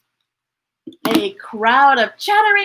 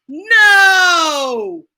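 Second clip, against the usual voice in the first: a loud, sobbing wail of no; wailing refusal; crying no; 5 semitones higher, 5 dB louder than usual. Acted. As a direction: cry it out like an upset child.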